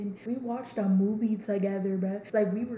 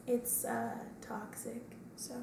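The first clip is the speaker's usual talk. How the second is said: hushed aside; whispering; 2 semitones higher, 9 dB quieter, slower than usual. Real, not acted. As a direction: whisper it like a secret. Confiding verdict.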